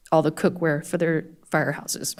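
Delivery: ordinary talk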